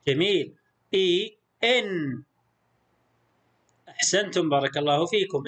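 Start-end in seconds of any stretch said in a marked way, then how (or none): 0.9-2.2 إي، إن slowly, the second closed on n; "i", then "in" with a lingering nun; vowel, then tanween. Shown as a demonstration. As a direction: demonstrate إي turning into إن, slowly. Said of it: "نضيف لها نون ساكنة خفيفة فنقول".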